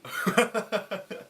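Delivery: laugh. Real, not acted. Laughing at the bully.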